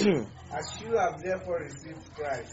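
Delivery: slow, loud verse reading; emphatic Bible reading. Shown as a demonstration. Reader repeating the verse at the pastor's request, slow and emphatic. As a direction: read it out slowly and firmly for all to hear. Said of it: "So tell them to read it like that again".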